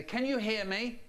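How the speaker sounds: lightly voiced parent demanding attention; impatient parent. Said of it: "They say".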